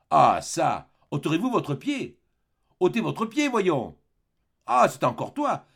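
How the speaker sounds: loud, irritated order; brushing off a pestering child; gruff annoyance. Acted. Convict tells child to leave.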